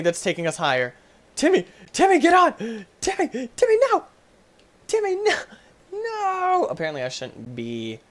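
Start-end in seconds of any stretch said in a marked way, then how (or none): 1.3-6.7 high frantic yelling; yelling a name. Pretend. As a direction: shout after the kid in panic. Calling out to Timmy.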